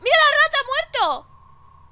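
high, loud exclamation; shocked outcry. Real, not acted. Gamer suddenly spotting the dead rat, shocked outcry. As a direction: cry out, drawing out the end.

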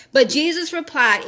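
usual speaking voice

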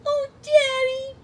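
very high, loud squeaky gush; Pikachu. Acted. Sidekick moved by daddy's reply, adoring squeak. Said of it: "Sure, Pikachu".

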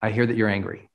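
calm reflecting. Shown as a demonstration. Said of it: "coach demos a calm reflecting reply".

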